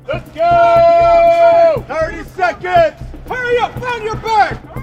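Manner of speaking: drill sergeant shout. Real, not acted. Drill sergeant hurrying recruits off bus.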